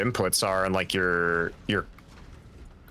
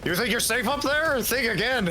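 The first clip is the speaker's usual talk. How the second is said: taunting; 10 semitones higher than usual. Pretend. Taunting enemy in game.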